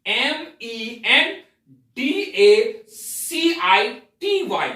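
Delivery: each letter spelled slowly and clearly; letter by letter, drawn out; slow spelling out. Shown as a demonstration. Teacher spelling mendha city slowly for students. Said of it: "मेंढा city".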